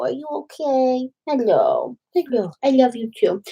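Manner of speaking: gentle dog-mom coo. Real, not acted. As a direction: check on the puppy tenderly. Talking to the puppy.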